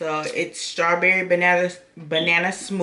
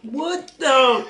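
high startled laughing gasp; shocked laugh; 6 semitones higher than usual. Real, not acted. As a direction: recoil laughing at the taste. Reacts to bad jellybean.

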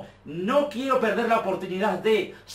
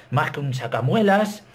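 ordinary talk, nothing marked

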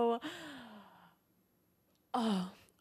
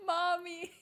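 laughing imitation; 7 semitones higher than usual. Mimicking the movie cry, laughing.

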